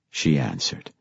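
ordinary delivery, nothing marked

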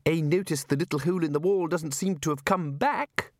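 high, mannered housekeeper voice. Acted. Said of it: "said Missus Whitlow".

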